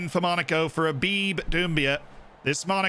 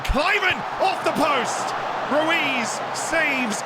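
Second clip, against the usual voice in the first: excited shout; 11 semitones higher, much slower than usual. Real, not acted. Streamer reacting to his striker hitting the post, excited shout. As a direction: shout as the shot hits the post.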